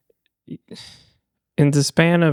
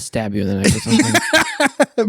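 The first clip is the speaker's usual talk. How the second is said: laughing. Real, not acted. Joking threat while laughing.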